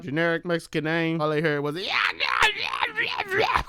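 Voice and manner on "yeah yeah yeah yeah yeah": jumbled shouted yeah yeah; mock intercom shout. Host imitating the voice heard over the intercom, shouting. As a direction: garble it like a bad intercom.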